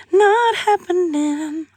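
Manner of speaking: high, slow, drawn-out no way; sing-song it like a firm no; sassy refusal. Real, not acted.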